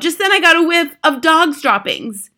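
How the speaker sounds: high, loud, agitated witch; yelling. A narrator's reading. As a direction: yell the alarm to the others. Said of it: "she yelled".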